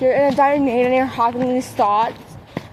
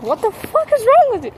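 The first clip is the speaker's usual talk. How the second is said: much higher, sharp retold outburst at mom; angry outburst; 9 semitones higher than usual. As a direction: fling the question out in disbelief and anger. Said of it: "And I'm like".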